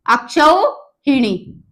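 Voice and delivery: अक्षौहिणी drawn out syllable by syllable, क्षौ fully voiced; slow careful spelling. Shown as a demonstration. Teacher spells the word for exam students, slow. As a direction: spell out अक्षौहिणी slowly for dictation. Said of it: "अक्षौहिणी होता है वो".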